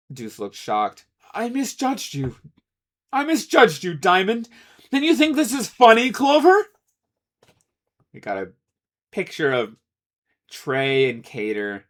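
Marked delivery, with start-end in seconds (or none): 1.3-6.7 high, loud betrayed reproach; hurt accusation. Acted. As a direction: throw the betrayal at them. Deuce accuses the upperclassmen.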